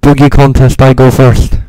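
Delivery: very loud announcing shout. Real, not acted. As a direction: bellow it at full volume. Shouting into the mic.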